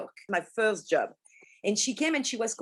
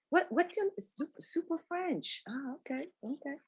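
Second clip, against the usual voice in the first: puzzled questioner; 6 semitones higher, 7 dB quieter than usual. Retold or imitated. Someone else asks about nickname.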